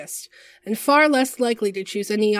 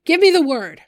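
high, loud battle yell; harsh raised voice; 8 semitones higher, 8 dB louder than usual. A narrator's reading. Side Piece running into the thick of the fight, harsh shout. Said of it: "raising her voice to a harsh pitch".

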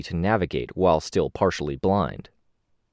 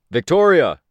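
loud, high shout of a name; raised, carrying yell; calling out; 10 semitones higher, 8 dB louder than usual. A narrator's reading. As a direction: call sharply across a battlefield. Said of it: "Tristan called out".

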